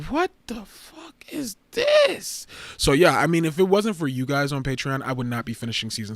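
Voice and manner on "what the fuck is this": high, slow, drawn-out what-the-fuck; aghast at a cursed lip sync; stretched-out shocked disbelief; disbelieving. Retold or imitated. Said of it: "I was like".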